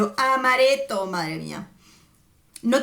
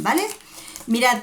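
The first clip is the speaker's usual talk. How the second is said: normal talking voice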